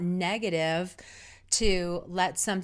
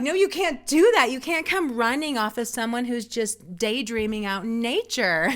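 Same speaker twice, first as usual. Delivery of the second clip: indignant outburst; 4 semitones higher, 6 dB louder than usual. Retold or imitated. Scolding runners who scare her.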